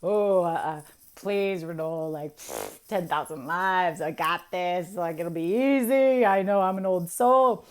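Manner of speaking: playfully overconfident begging; mock overconfidence. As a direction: brush off the warning, building to a loud boast. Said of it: "And you're like".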